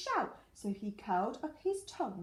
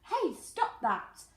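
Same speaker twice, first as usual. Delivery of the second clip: mildly cross rabbit; 6 semitones higher, slower than usual. Acted. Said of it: "hopping mad".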